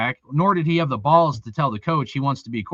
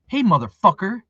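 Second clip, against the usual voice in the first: usual speaking voice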